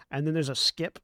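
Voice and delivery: ordinary delivery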